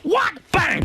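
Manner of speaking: very high-pitched cry, then bang; mock outraged shriek. She ends the call after his jibe, mock shriek. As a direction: act out her shrieking and slamming down. Re-enacts her outburst ending the call.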